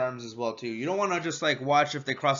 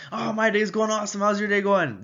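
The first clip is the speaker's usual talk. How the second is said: higher, louder overeager answer; mismatched overexcited reply; 6 semitones higher, 4 dB louder than usual. Shown as a demonstration. Salesman overexcited at a calm customer, higher and louder. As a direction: overdo the energy. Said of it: "instant rapport is lost".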